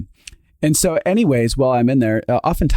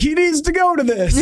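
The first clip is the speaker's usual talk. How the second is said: laughing mock old man.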